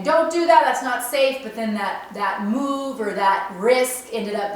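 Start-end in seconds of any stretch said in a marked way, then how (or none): 0.0-1.4 higher, louder warning voice; inner saboteur. Retold or imitated. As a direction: voice the inner saboteur urging caution. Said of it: "my saboteur was saying".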